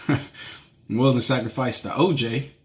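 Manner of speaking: laughing through a joke about the orange juice. Real, not acted. Laughing at his own joke to listeners.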